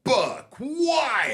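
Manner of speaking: high, excited announcer call. Pretend. Halo voice actor calling out a medal, high announcer voice. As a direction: punch the medal name out like the game.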